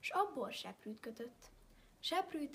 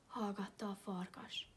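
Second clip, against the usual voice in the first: ordinary delivery, nothing marked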